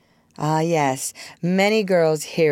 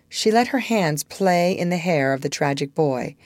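usual speaking voice

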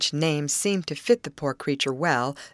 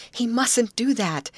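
urgent plea, higher pitched and slow; appealing; 7 semitones higher, 4 dB louder, slower than usual. A narrator's reading. Molly to her chums about the timid stranger, appealing. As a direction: plead with a touch of urgency. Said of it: "she seemed appealing to her chums".

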